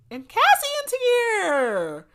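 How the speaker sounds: very high, loud, drawn-out cry; hammed-up excited shriek. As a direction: squeal the arrival out slowly, high and loud. Announces a dramatic arrival in recap.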